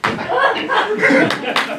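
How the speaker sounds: high burst of real laughter. Real, not acted. Laughing before starting the story. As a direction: crack up at the opening.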